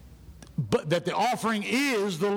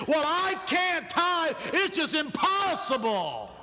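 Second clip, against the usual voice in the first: high, slow whine of an excuse, drawn out; mock excuse-making; 7 semitones higher, slower than usual. Preacher mimics members dodging the tithe, mock helpless excuse. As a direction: draw out each word like a hopeless objection. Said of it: "making excuses about finances".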